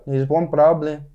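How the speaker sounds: mock-Mexican cartoon accent; playful cartoon Mexican delivery. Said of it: "you saying problem like that".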